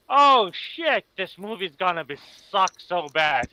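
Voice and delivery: high loud yelled outburst; retold yell. He retells yelling at the screen during the movie, loud and high. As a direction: shout it out in the theater. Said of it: "but I yelled out".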